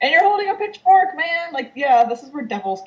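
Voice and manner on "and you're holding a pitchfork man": mock outrage. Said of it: "And, like".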